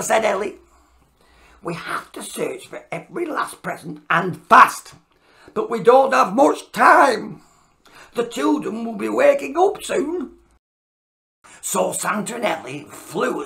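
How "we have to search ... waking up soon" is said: urgent elf. Acted. Elf urging haste.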